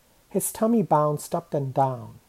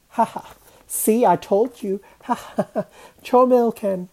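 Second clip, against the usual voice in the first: high, loud crowing with acted ha-has; gleeful I-told-you-so; triumphant gloating; 6 semitones higher, 6 dB louder than usual. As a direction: crow over being proved right. Mahmud gloats as the cat floats.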